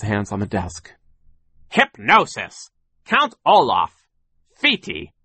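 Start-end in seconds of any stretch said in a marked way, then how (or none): 1.7-5.1 slow, high, loud exclamations; spaced-out indignant shouts; scornful outburst. A narrator's reading. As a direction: throw each excuse back loudly. Sir mockingly repeats their excuses.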